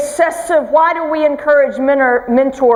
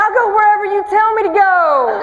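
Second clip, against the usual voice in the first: swooning; 7 semitones higher than usual. Retold or imitated. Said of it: "I'm like".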